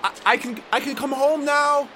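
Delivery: high, loud cry of joy; high loud elated exclamation; overjoyed. Acted. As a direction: burst out with disbelieving joy. Realizes rescue possible.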